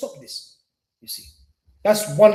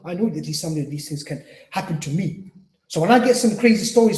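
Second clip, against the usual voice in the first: ordinary talk, nothing marked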